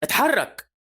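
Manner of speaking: high-pitched urgent command; urgent shout. Acted. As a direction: order it out sharply, pitch jumping up. Urgent order in runaway carriage.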